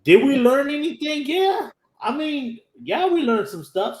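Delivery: mock-doubtful high squeal easing down; high-pitched self-questioning. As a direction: pitch it way up, then ease back. Asks and answers own question.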